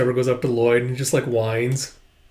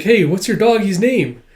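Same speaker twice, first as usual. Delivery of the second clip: lightly voiced high little kid; childlike curiosity; 6 semitones higher, 3 dB louder than usual. Child asking about the dog, childlike curiosity. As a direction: ask like an eager child.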